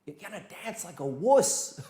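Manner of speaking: laughing put-down; teasing laugh. Shown as a demonstration. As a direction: tease with a laugh at the end. Mocking the mouse-like counter.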